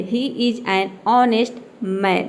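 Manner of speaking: "he is an honest man" said very slowly, word by word; slow example sentence. Shown as a demonstration. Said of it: "तो ये क्या हो गया".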